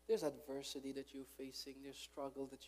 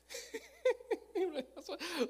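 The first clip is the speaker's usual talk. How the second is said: high burst of laughter; 9 dB louder than usual. Real, not acted. Laugh after joke.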